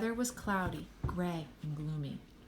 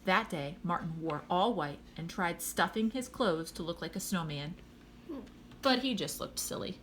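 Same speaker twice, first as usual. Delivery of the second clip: plain reading voice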